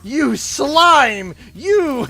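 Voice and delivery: high, loud furious shouting at a driver; enraged mom yelling. Retold or imitated. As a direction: scream at the driver who cut you off. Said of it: "I go off".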